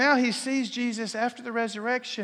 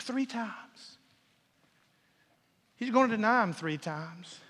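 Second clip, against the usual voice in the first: normal talking voice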